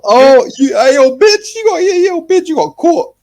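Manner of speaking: high-pitched, loud hammed-up jeering; over-the-top squealed "caught you" taunt; mock gloating taunt. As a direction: crow it loudly, way up high, as a mock gotcha. Acting out a smug gotcha taunt.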